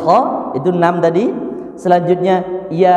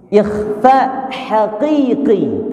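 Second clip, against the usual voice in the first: ikhfa haqiqi stretched syllable by syllable; drawn out slowly; much slower than usual. Shown as a demonstration. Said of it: "Apa namanya?". (ucapkan ikhfa haqiqi perlahan)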